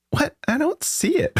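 high puzzled squeak; baffled. Retold or imitated. Staring at a video thumbnail, a high baffled squeak. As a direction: squint at the screen, confused. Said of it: "going like".

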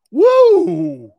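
high, loud "woo"; long excited cheer; whoop. Real, not acted. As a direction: let out a big whooping holler. Cheers after naming the matchup.

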